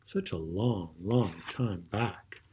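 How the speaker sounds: whispered storybook opening; a soft secret hush to begin; hushed start. A narrator's reading. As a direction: draw them in with a whisper.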